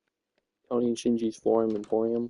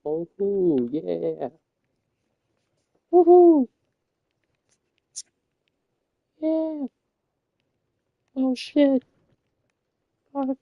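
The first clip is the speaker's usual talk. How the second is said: much higher, louder woohoo; excited whoops; 14 semitones higher, 5 dB louder, much slower than usual. Real, not acted. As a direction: whoop at the finish. Finishing the wild ride.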